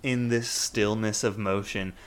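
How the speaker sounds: usual speaking voice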